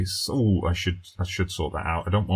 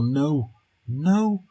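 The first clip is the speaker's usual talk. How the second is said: a much higher, louder disbelieving no; shocked no; 8 semitones higher, 3 dB louder than usual. Real, not acted. Manager watching his penalty taker, shocked and high. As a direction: gasp the word out as the penalty goes.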